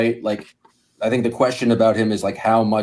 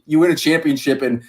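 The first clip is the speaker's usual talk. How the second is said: ordinary talk, nothing marked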